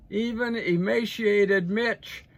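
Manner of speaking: a drawn-out public jeer; mocking nickname again. Real, not acted. Jeering at McConnell for the audience, slow and loud.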